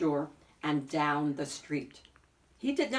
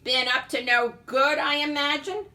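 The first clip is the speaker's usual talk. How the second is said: higher, louder suspicious landlady voice; grumbling landlady; 8 semitones higher, 7 dB louder than usual. A narrator's reading. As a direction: grumble it at the tenant on the stairs. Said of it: "grumbled his landlady".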